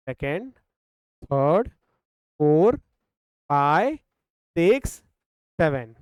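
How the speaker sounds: each count ending in a sharp rising tune; counting aloud. Shown as a demonstration. Teacher counting calculator presses for the class, each rising. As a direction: tick off each number with a lift, like pressing a key. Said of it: "जोर से बोल रहा हूँ".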